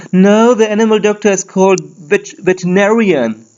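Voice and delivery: a lightly voiced pedantic English speaker; mock correcting voice. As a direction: correct someone smugly.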